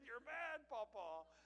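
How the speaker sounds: high, loud playful scolding; loud, high mock reproach; teasing scold. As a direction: scold grandpa fondly. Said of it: "I said".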